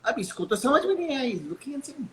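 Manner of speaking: usual speaking voice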